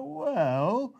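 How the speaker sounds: high, drawn-out "well", rising; mock doubtful. Listener doubting he's still the son, mock doubtful. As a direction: imitate someone unconvinced and hedging. Someone doubting the usual answer.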